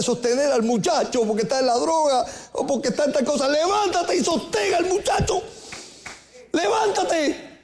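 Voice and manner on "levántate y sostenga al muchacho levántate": high, slow ringing command; preaching peak. Acted. Preacher urges the weary mother up, high and drawn out. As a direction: soar up and stretch it.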